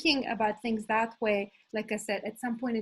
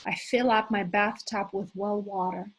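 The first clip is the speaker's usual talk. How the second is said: normal talking voice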